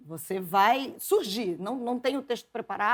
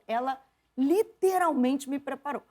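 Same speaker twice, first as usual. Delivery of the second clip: ordinary talk, nothing marked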